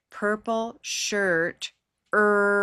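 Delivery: "purple shirt" pelan lalu "er" ditahan; held er sound. Shown as a demonstration. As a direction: tahan bunyi "er" panjang tanpa menyentuh apa pun di mulut. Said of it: "Notice I can just hold it".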